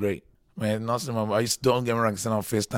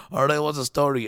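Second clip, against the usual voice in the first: louder, higher mock Irish greeting; imitated Irish accent; 5 semitones higher, 7 dB louder than usual. Irish locals greeting a newcomer on the street, imitated Irish accent. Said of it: "I didn't understand the Irish accent".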